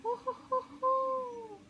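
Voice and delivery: high hooting owl call; high owl hoots. Pretend. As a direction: hoot like a cartoon owl. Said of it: "I don't know why I talk like that".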